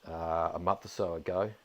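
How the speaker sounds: ordinary talk, nothing marked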